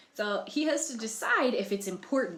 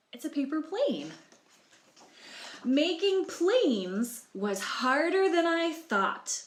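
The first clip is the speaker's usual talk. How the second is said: lively read-aloud in the egg's first person, slow on the struggle; story narration; 5 semitones higher, 5 dB louder, slower than usual. A narrator's reading. Librarian reading Humpty Dumpty's story to children, storybook voice. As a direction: tell it like a storybook to young listeners.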